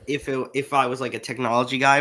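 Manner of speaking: normal talking voice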